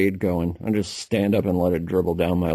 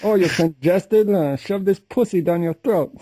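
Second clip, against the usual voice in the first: high, loud put-on Chinese accent in broken English; mock Chinese accent; 9 semitones higher, 3 dB louder than usual. Friend imitating the wife selling a cure in a Chinese accent. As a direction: ham up a Chinese herbal seller's accent. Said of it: "That's a great accent".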